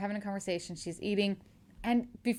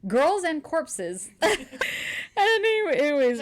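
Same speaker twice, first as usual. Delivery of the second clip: slow, higher pitched, breaking into laughter; laughing reveal; 5 semitones higher than usual. Real, not acted. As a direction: savor the absurd title and crack up. Laughs at the prank's name.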